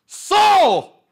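one high, booming shout; imitating dad's yell. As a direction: bellow it like dad silencing the car. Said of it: "qué hacía mi papá en el carro". (imitando el grito de papá)

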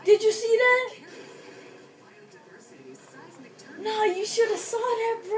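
high, loud cries of disbelief; worked-up outcry. Real, not acted. Gamer wanting friends to see the near success, worked-up outcry. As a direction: cry out, pitch way up, at a near miss.

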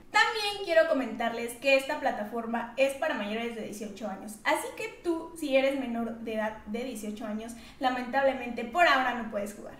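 ad read. Acted. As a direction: read the sponsorship smoothly. Sponsor ad read.

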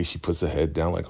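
usual speaking voice

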